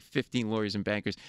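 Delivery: ordinary talk, nothing marked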